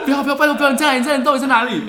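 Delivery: high, loud, half-laughing mock panic; retold frantic pleading. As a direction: replay the desperate begging, laughing at it. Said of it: "我就传讯跟我说". (笑着重演慌张哀求)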